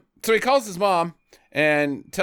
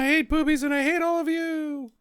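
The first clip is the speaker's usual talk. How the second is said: put-on childish tantrum; 11 semitones higher than usual. Mocking character tantrum.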